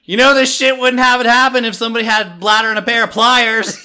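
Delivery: loud, high strained yell; shouted stand-up punchline; hollering comic impression. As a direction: holler it down from the cross. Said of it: "he's hanging up there and he's like".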